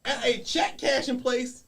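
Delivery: incredulous laughing rant. Retold or imitated. Lawyer ribbing a client who cashed a stolen check, slow and loud. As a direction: spell out the stupidity, building. Mocking client's stupid crime.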